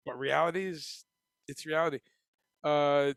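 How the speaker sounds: ordinary talk, nothing marked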